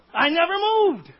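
loud, high ringing proclamation; loud declaration. Retold or imitated. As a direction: proclaim it as God's answer. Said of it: "what God says".

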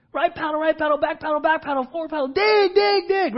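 high, loud rapid-fire paddle calls; yelling commands. Retold or imitated. Guide yelling orders mid-rapid, loud and urgent. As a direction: shout the calls over the rapids. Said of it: "and he's yelling".

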